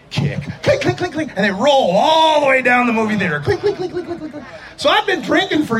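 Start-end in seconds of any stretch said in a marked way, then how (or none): 0.6-1.3 high, rapid bottle clinks; high-pitched clink-clink sound effect; clinking bottles imitation. Imitates bottles kicked down the aisle. 3.4-4.5 high clinks fading away; high-pitched clinking that dies down; rolling bottles imitation.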